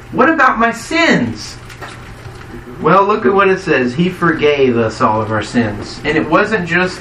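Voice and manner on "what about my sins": mildly protesting, pitched-up question; doubter's objection. Acted. Preacher voices a doubting listener's objection, mildly protesting. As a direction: raise it as a worried objection, higher.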